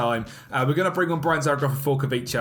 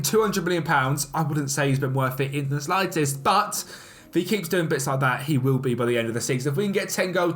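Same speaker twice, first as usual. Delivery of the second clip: ordinary talk, nothing marked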